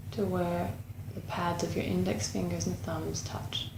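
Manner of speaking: unhurried meditation guide, long pauses between phrases; guided meditation. Acted. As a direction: guide them gently inward, leaving space after each phrase.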